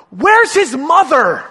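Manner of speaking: high, loud, slow disbelieving cry; incredulous wail about the absent mother; exasperated. Retold or imitated. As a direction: throw up your hands at the screen. Exasperated viewer's question.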